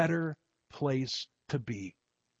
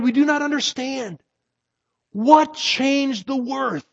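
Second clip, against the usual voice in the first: higher and louder, bewildered; crowd crying out; 11 semitones higher, 8 dB louder than usual. Acted. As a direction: call out in puzzled wonder. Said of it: "some of them cried".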